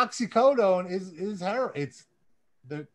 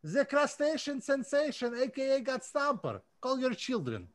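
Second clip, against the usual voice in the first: put-on Russian accent; 4 semitones higher than usual. Reading fan names aloud to listeners in a light mock Russian accent. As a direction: lightly play a Russian announcer. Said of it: "I'm gonna do it with a Russian accent for you".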